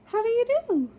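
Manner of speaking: very high, sweet princess voice; polite princess greeting. A narrator's reading.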